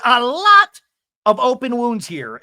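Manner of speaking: mock-theatrical wounded star; dramatic lament. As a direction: overplay the hurt for effect. [name] dramatic reunion line.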